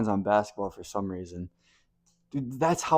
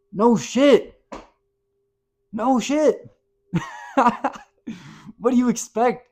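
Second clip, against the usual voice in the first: much higher and louder, laughing at the obvious; incredulous outburst; 10 semitones higher, 7 dB louder than usual. Real, not acted. Telling viewers rivals will be mad, loud amused disbelief. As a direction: scoff with a big amused laugh. Mocking the other team's anger.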